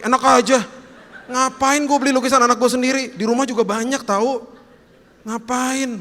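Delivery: high, loud indignant refusal; indignant protest. Acted. Father balking at buying his own child's painting, indignant. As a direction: balk in a high, affronted voice.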